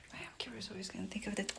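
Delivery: quick, soft unvoiced whisper; fast whisper. Real, not acted. Owner whispering to camera while watching the kitten. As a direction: rush it under your breath.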